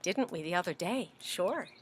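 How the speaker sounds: ordinary delivery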